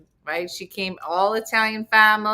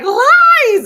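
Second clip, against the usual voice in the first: much higher, much louder cry of protest; indignant shout; 14 semitones higher, 14 dB louder than usual. Retold or imitated. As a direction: yell it like a mother refusing the truth. Mom denies the DNA result.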